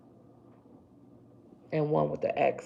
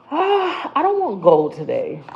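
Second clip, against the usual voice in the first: much louder, higher "Ah" then firm refusal; emphatic no; 10 semitones higher, 10 dB louder, slower than usual. Real, not acted. Choosing eyeshadow for viewers, loud emphatic refusal. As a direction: push away the gold with a loud exclamation.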